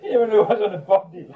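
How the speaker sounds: high, loud, through laughter; amused outburst, words riding on laughs; laughing. Real, not acted. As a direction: crack up while telling it. Cracks up at his own anecdote.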